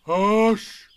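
terrible voice. A narrator's reading. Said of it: "said Eeyore in a terrible voice".

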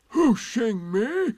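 loud, high, slow disbelief; indignant, drawn-out question; surprised. A narrator's reading. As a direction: echo it in affronted amazement. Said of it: "said Eeyore in surprise".

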